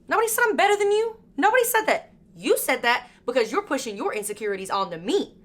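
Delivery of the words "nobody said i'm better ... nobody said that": much higher, fast and exasperated; indignant rebuttal. Real, not acted. Talking back to hometown critics, high and indignant. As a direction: throw the accusation straight back.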